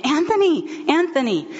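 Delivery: high, urgent call across the room; calling out a name. Retold or imitated. Wife running to her husband, calling his name urgently. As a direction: call him by name as you rush toward him. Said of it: "calling out his name".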